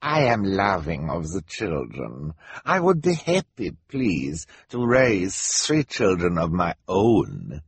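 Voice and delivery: slow fake foreign accent; smarmy, drawn-out false fondness; villain Gunter.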